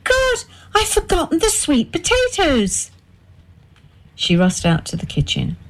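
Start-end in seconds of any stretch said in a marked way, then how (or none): 0.0-2.9 high, sudden cry of dismay; she's just remembered the potatoes, cry it out; high panicked realization. A narrator's reading. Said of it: "Margaret let out a cry".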